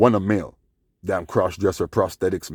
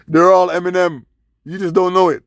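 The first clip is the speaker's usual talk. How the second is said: loud, high insistence; emphatic shouting; 10 semitones higher, 8 dB louder than usual. Real, not acted. Man telling listeners they're all [name], emphatic shouting. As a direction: shout it as the big reveal. Insisting on theory after laughing.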